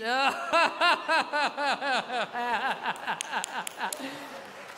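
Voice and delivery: long, stuttering mock-wealthy chuckle; rich person's laugh. Comic mocking a Swiss audience's laugh, long rich laugh. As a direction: imitate a rich laugh, drawn out in a long run of ha-has. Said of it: "même votre rire, il est riche".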